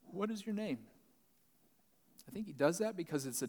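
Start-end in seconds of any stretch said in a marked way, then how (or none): 0.0-0.9 mildly acted step back, pitched higher; high-pitched question, lightly voicing someone taken aback. Jesus steps back from the demon, mildly taken aback. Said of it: "Jesus kind of steps back".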